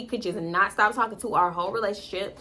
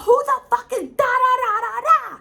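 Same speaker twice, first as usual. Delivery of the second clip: high, loud singsong demand; retold angry yell; 6 dB louder than usual. Yelling at boyfriend about the other girl, angry.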